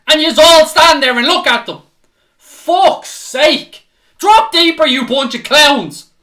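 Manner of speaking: screaming at the defenders in fury; furious fan rant; furious yelling. Real, not acted. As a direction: roar it out, red-faced with rage.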